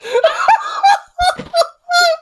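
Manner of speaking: high shrieking laugh; hysterical laughter. Real, not acted. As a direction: cackle uncontrollably.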